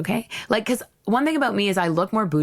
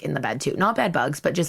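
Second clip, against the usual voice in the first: ordinary delivery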